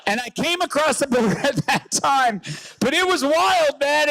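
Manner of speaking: usual speaking voice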